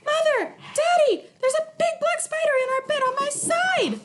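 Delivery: put-on very high, loud child's scream; frightened girl screaming for her parents. A narrator's reading. Girl runs screaming to parents about spider. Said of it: "went screaming into her mother's".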